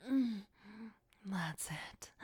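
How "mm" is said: savouring hum. Acted. Savouring the blood.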